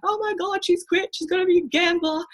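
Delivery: high, hammed-up parental panic; panicking parents. Parents on the phone having a meltdown, high and panicked. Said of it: "a freaking meltdown".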